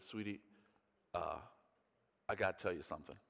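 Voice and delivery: quiet, hesitant confession; soft serious. Retold or imitated. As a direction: break the news gently to his wife. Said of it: "I said".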